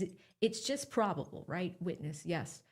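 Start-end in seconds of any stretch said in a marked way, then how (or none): none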